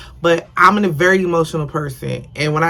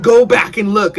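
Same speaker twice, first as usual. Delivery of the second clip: voice of God; 4 semitones higher, 5 dB louder than usual. Retold or imitated. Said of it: "something was telling me".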